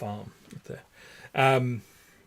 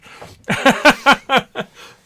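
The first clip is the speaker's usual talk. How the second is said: loud, high laugh at his own joke; real laughter, high and loud; 12 semitones higher, 13 dB louder than usual. Real, not acted. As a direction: crack up after the punchline.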